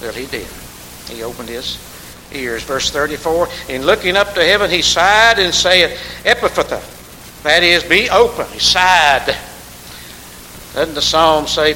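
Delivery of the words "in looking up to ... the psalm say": louder scripture proclamation; fervent preaching. Acted. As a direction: proclaim the verse with force. Preaching at full force.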